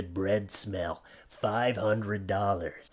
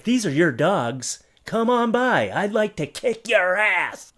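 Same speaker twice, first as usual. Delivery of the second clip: raised, ending in a shout; mock announcement; 7 semitones higher, 5 dB louder, slower than usual. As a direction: read it like a shelter notice, then blow up. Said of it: "that was a nice little touch in the end there".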